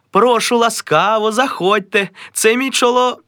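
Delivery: high, welcoming hostess; a high, sweet hostess; gushing hostess. Acted. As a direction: welcome the guests warmly.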